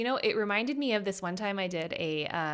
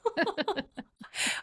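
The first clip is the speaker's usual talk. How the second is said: teary laugh; 9 semitones higher than usual. Real, not acted. Overwhelmed, tearful laughter.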